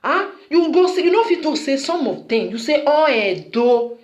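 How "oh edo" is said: slow, stretched-out imitation; drawn-out quote. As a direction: quote it slowly, dragging each word. Quotes others' sweeping remark, drawn out.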